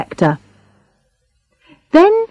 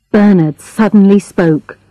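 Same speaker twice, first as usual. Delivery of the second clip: ordinary delivery, nothing marked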